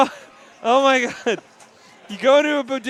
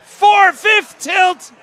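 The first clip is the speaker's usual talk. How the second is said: announcer shout; 10 semitones higher, 10 dB louder, much slower than usual. Real, not acted. Announcing pinball tilt.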